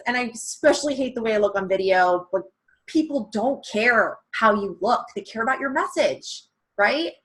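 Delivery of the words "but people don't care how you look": normal talking voice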